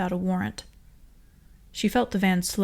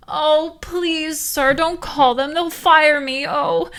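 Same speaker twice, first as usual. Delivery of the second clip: high, loud wailing begging; a hammed-up desperate driver begging the guard; wailing plea; 9 semitones higher, 9 dB louder, much slower than usual. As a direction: wail and plead for mercy. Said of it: "The G Z let out a wail".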